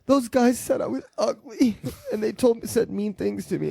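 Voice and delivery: high, mock-weepy girl voice; hammed-up tearful woman quoting the bikers; crying girl. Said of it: "And she was like".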